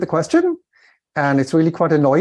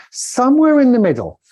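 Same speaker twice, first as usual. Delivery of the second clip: "Somewhere" pitched up and louder, falling away; rolling inflection; 9 semitones higher, 4 dB louder than usual. Shown as a demonstration. Coach showing a middle range between Alps and flat, rolling inflection. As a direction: lift SOMEWHERE high, then glide down through "in the middle". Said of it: "We've got sort of hills".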